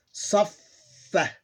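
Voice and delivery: "saffa" with a long held f in the middle; slow "saffa", both f sounds merged; combined double consonant. Shown as a demonstration. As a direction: stretch the f in "saffa" into a short a. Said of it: "So we combine these two".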